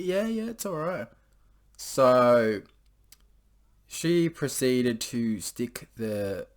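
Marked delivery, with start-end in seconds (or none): 0.0-1.1 lightly voiced eager guy, pitched up; high mock guy voice. Girl hears the eager guy reassure her, high mock voice. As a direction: squeak it out like the eager boyfriend.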